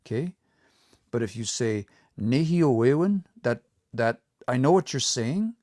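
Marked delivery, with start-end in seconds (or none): 2.2-3.2 Nehio, long form, slowly with unshifted stress; learners' misplaced stress. Retold or imitated. Teacher shows learners' mistake, Nehio with misplaced stress. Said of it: "still pronouncing the stress like they hear it on this one".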